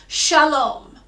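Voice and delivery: Shalom said slowly, shah-LOME; Shalom pronunciation. Shown as a demonstration. Teacher to choir: Shalom, slow and clear. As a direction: model Shalom for repeating. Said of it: "just repeat after me".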